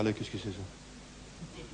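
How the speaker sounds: hearing a knock at night, scared and hushed; scared whisper. Acted. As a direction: breathe the question fearfully in the dark.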